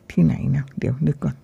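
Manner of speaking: whisper. Real, not acted. Thinking aloud to herself.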